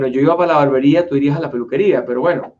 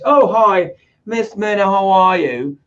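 put-on British greeting, raised pitch; British accent; 2 semitones higher than usual. Newcomer to the United States greeting someone, mock British accent. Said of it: "hablas con un acento british".

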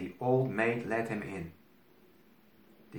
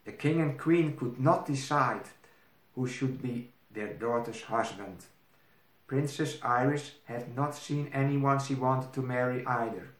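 plain reading voice

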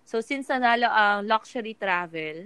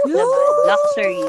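much higher, louder, cheering it out; triumphant; 7 dB louder than usual. Acted. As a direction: crow the word luxury in triumph. Repeating the winning category, triumphant.

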